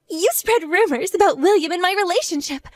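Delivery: ordinary delivery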